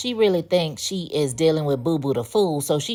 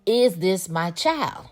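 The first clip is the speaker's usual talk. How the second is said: retold demand; 3 semitones higher, slower than usual. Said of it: "she jumped, I jumped".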